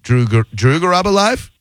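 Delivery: Drew Garabo live climbing steadily into a question; unsure rising tune through Garabo live. Shown as a demonstration. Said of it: "Every time you say it one way, I'm gonna say it another".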